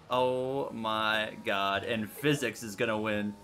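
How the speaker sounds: low, soft, very slow resigned groan. Real, not acted. Streamer accepting the loss, low resigned groan. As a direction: sink into defeat.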